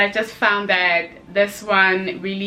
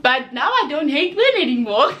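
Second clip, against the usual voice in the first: words spilling through laughter, higher and louder; laughing while talking; 7 semitones higher, 5 dB louder than usual. Real, not acted. Admitting she no longer hates men, laughing through it. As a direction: laugh your way through the confession.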